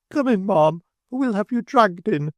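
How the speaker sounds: high, imperious order; commanding call. A narrator's reading. Mrs Sparsit ordering the old woman out, high and commanding. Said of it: "reversing her former word of command".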